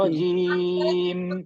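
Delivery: رجیم held very long, lips closed at the end; very slow model reading of رجیم; drawn-out recitation demo. Shown as a demonstration. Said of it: "ہونٹوں کو بند رکھ کر چھوڑ دینا بس".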